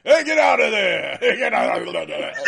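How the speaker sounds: loud seal impression, breaking into laughs; talking seal. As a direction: blurt it out like a blathering seal. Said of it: "floating in the aquarium going".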